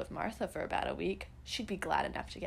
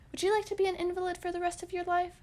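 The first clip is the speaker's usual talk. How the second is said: high, quick mock-scolding mother; a hint of a worried mother, pitched up and hurried; fussing mother; 11 semitones higher, 3 dB louder, faster than usual. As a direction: voice Mother's fretful warning, fast and high. Mother's warning voiced in story.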